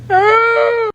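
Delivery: high, loud despairing wail; panicked scream. Acted. Broke after skincare.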